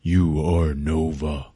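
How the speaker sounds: lightly voiced solemn ape; slow, low and deliberate, bestowing a name; solemn naming.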